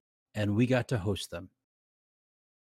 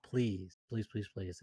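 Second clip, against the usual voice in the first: soft pleading; 2 semitones lower, 9 dB quieter than usual. Real, not acted. Pleading, fading quiet.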